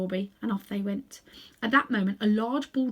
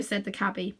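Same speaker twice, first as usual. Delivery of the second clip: usual speaking voice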